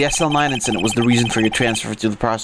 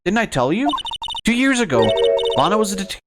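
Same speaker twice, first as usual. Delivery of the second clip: put-on higher, perky girl voice; lightly voiced young girl; 8 semitones higher than usual. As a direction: play the eager young sister. Reading Emma's dialogue.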